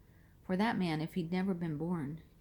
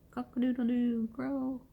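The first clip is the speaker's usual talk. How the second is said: rooster crow; 6 semitones higher than usual. Pretend. Rooster crows after denial.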